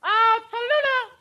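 high call for the dog; calling out again. Acted. Costello calling again as Abbott asks, high and drawn. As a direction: call the name out again into the house. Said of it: "Try it again, you'll see".

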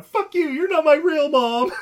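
high, loud hammed-up outburst; mock tantrum yell. Buddy defying his owner, hammed-up furious yell.

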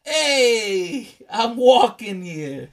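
loud, drawn-out mock New York Italian accent; Italian-American accent. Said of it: "That's why we're doing accents".

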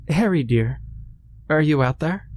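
high, sweet calling voice; Meredith calling. A narrator's reading. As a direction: call out sweetly from far away. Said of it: "Meredith's voice reached his ears".

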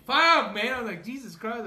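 real alarm, loud and high; shocked yell. Real, not acted. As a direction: cry out at the empty radiator. Radiator found empty.

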